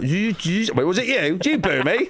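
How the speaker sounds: high-pitched jumpy accusation; mock confrontation. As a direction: challenge the hecklers in turn. Confronts booers in retold stunt.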